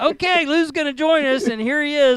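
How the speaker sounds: loud, high hype intro; excited announcer. Acted. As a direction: announce the guest with big energy. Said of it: "I think I need to come up just randomly say".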